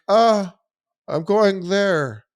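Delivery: put-on high, slow, spaced-out girl; dazed high voice. Tripping girl tells her friends in a high, slow, dazed voice. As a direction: drift the words out, dazed and high.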